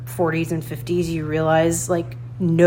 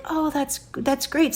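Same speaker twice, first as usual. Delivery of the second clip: high encouraging; 8 semitones higher than usual. Retold or imitated. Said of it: "But I was like".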